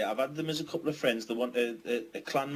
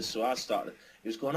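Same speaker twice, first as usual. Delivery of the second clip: ordinary delivery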